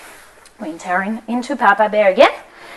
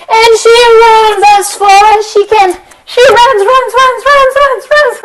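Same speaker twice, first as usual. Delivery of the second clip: excited; 10 semitones higher, 8 dB louder than usual. Acted. Goldilocks escaping, excited telling.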